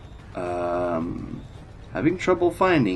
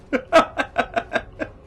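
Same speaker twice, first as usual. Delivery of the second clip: breathy burst of laughter; laughing. Real, not acted. Laughing at the guards' refusal.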